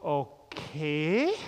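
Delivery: drawn-out, questioning okay; skeptical okay. Pretend. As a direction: humor a friend with a doubtful, rising okay. Imagined puzzled guest's reaction.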